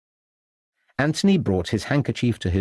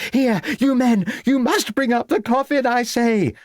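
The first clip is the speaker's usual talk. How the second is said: put-on very high, imperious old lady; raised voice; 3 dB louder than usual. A narrator's reading. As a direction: order the men about at high pitch. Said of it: "raising her voice".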